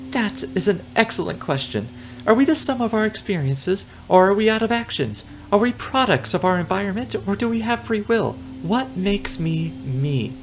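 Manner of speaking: high, dreamy pondering cockroach; philosophy student. Acted. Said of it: "recognize a first-year philosophy student".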